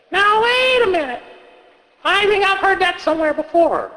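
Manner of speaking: put-on high kid voice, suspicious; a kid catching a contradiction; puzzled child. Child questioning the storyteller in a high kid voice.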